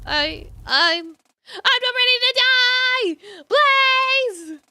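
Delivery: high, loud sobbing plea; crying, terrified scream. Acted. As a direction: break into a terrified wail. Trapped in fire, crying out.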